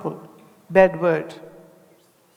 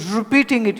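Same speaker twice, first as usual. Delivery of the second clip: usual speaking voice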